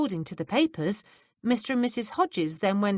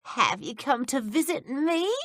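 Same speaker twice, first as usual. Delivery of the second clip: put-on quavery old woman; 7 semitones higher than usual. A narrator's reading. Old Miss Bush voiced.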